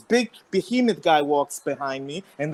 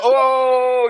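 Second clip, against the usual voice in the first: loud, raised mock crowd "oh"; crowd's taunting oh; 5 semitones higher, 5 dB louder than usual. Onlookers reacting to a man being snubbed, mock taunting. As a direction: imitate onlookers egging him on. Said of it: "everybody's like".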